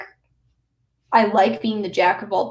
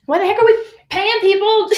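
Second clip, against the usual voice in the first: incredulous boss; 10 semitones higher, faster than usual. Retold or imitated. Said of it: "they were like".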